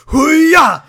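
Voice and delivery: loud, high leaping cry; mock action yell. Voicing Kyle as he jumps out the window, mock battle cry. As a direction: launch it like a hammy action hero.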